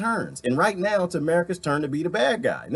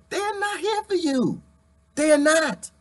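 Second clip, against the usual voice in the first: pitch; 12 semitones higher, slower than usual. Real, not acted. High-pitched ranting.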